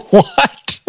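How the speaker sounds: high, startled what; shocked disbelief at the officer's news; a mock-startled squeak of what; retold surprise. Told the sticker's wrong side.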